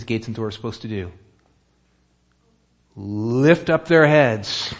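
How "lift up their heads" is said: slow, loud proclamation of the command; proclaiming command. Acted. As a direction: call it out slowly like a herald. Preacher proclaims the psalm's command.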